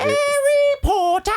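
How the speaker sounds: very high, slow squeaky house-elf cry of Harry Potter; Dobby voice. Pretend. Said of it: "if I do Dobby or Helena Bonham Carter".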